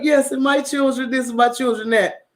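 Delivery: prim, put-on posh voice of the mother; mock society lady bragging; bougie mom. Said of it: "trying to be bougie".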